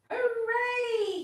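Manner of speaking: high, loud hooray; joyful shout. Acted. Cuddle Pie seeing the wind, shouting for joy. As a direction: leap up and cheer it out. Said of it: "he shouted".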